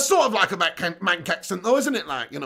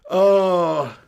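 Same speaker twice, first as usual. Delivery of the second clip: ordinary talk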